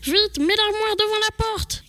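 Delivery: ordre aigu et pressé, joué; cri pressant. Acted. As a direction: crie l'ordre pour sauver son frère. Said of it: "cria Baptiste". (appel aigu et paniqué)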